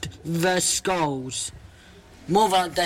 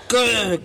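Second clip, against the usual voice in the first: a higher playful growl from a dinosaur; 4 semitones higher than usual. Acted. Little dinosaur growling at the skeleton, playful growl. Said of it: "growled the dinosaur".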